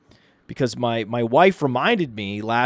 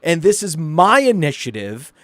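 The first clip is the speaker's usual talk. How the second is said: higher, emphatic, indignant telling-off; furious wife; 5 semitones higher, 4 dB louder than usual. Retold or imitated. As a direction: imitate a wife angrily putting her husband in his place. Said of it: "And she was, like, furious".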